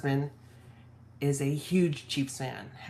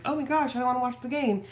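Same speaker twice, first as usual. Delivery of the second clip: high-pitched imitated enthusiasm; mock eagerness; 8 semitones higher than usual. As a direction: pitch it up like an eager fan, playfully. Acts out running joke's eager line.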